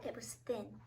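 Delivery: very soft storytelling; tender, quiet telling; hushed narration. A narrator's reading. Tender hush over the lone kitten.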